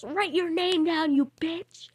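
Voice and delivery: high, drawn-out imitation of yelled orders; mock yell. Teacher ordering student to sign in, mock yell. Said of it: "She just yells at me".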